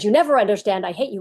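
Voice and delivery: a hint of a screaming ten-year-old; mild mock scream. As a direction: quote the kid's scream without really screaming. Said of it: "Ten-year-old screams".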